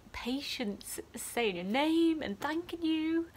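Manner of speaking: tearful, voice thinning and rising at the end; crying from happiness. Real, not acted. Student nurse on patients' thanks, really choking up. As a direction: choke up with happy tears. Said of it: "I'm crying because I'm happy".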